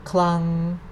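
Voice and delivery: Clung held even on one pitch; steady tone on Clung with no glide; level-tone demo. Shown as a demonstration. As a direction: keep Clung long and level. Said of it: "Say that again".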